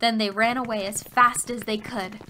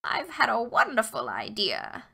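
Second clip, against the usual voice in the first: put-on old hag voice. A narrator's reading. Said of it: "said the old hag".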